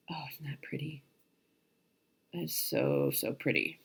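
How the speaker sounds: hushed, breathy admiring whisper; soft delighted whisper over the fabric. Real, not acted. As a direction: whisper it as if it's precious.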